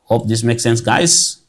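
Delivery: usual speaking voice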